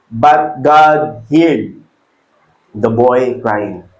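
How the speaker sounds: slow, hushed reading of the verse; solemn scripture reading. Acted. Preacher to congregation, slow hushed verse reading.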